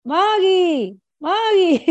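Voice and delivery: high mock yell from bed; imitated calling out. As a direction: imitate an old mother hollering for her daughter. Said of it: "mi mamá de la cama me gritaba".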